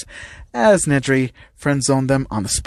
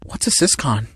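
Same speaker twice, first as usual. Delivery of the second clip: ordinary delivery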